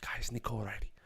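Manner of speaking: lightly voiced fussing manager; Guardiola impression. Shown as a demonstration. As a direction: toss it off under the breath like a manager's aside. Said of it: "You just say guys and go".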